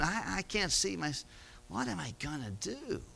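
despairing. Acted. Steward despairs over losing job.

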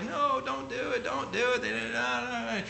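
high, pleading mock-mom voice; imitating mom. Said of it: "mom's like".

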